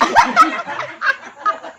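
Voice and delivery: high squealing burst of laughter. Real, not acted. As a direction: break up laughing at the joke. Laughing at the mustache joke. (tawa melengking)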